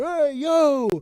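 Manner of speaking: high, loud street call; tough Bronx greeting. Retold or imitated. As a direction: throw out a brash Bronx hey yo. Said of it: "I'm more of like your".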